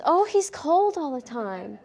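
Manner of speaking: slow, lightly mocking owner voice; owner's excuse. Instructor mimicking owners at the clinic, lightly mocking. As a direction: imitate a naive owner. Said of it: "everybody comes in and says".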